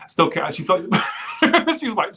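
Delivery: real laughter breaking into the words; cracking up. Real, not acted. Fan retelling a comic's joke, cracking up. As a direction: laugh too hard to get the quote out.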